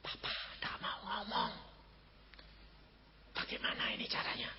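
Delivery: whispered, worried aside. Retold or imitated. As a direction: hush it like a secret by the sickbed. Sibling worried at father's bedside. (berbisik cemas)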